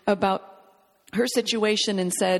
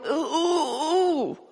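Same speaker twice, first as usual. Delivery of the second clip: drawn-out high "ooh" wail; groan of struggle; 5 semitones higher, much slower than usual. Acted. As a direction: act out wrestling with a hard word. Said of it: "that we struggle with".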